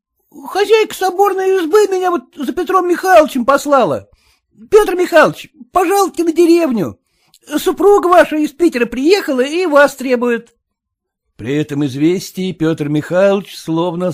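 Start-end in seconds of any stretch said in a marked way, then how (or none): 0.1-10.6 put-on boy voice. A narrator's reading. Boy delivers urgent news.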